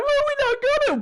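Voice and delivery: very high, silly put-on voice; mock reply. Host replaying his own answer to Shaky, squeaky and high. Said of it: "And then I was like".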